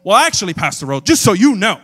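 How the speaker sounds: loud, emphatic smug corrector; mock pedant piping up at volume; know-it-all correction. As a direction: correct someone loudly and pointedly. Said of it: "I didn't just go".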